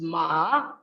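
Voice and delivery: MA dips low, then rises; drawn-out MA, down then back up; dipping tone. Shown as a demonstration. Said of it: "low or falling then rising pitch".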